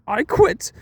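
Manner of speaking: hammed-up butler finally snapping; imitating furious Alfred. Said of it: "And Alfred's so pissed".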